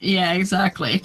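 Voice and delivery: usual speaking voice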